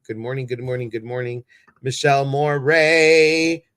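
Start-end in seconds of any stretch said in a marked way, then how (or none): 1.8-3.6 loud, high, stretched name call. Real, not acted. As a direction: call the name out, drawing it long. Calls out viewer's name.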